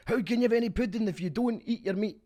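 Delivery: high, loud mock-Scottish shout; English take on Scottish. Showing how the band does it, English-person's Scottish accent. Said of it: "imagining an English person doing an impression of a Scottish guy".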